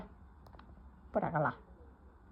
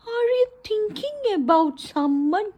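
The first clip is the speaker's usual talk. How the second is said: sing-song coo; 10 semitones higher, much slower than usual. Real, not acted. Teasing dog sing-song.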